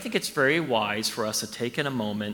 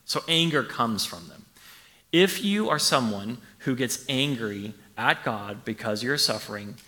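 normal talking voice

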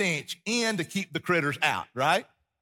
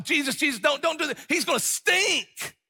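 pleading Martha; 11 semitones higher, 4 dB louder than usual. Retold or imitated. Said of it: "Martha's like".

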